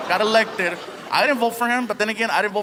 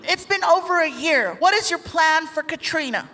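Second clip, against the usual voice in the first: reporter's question; 7 semitones higher than usual. Retold or imitated. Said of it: "especially during press conferences".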